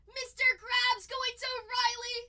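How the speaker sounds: loud, high, slow announcing call; host calling out the winner's name, excited; excited announcement. Real, not acted.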